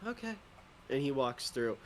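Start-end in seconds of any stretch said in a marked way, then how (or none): 0.1-0.4 high, soft little agreeing voice; small okay. Acted. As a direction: chirp it meekly. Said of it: "You just hear Bingley go".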